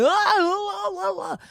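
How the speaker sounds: high, loud yelps of swerving carts; high mock alarm cries. Narrator imitating wobbly ikea carts, high yelping cries. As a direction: yelp like a cart careening.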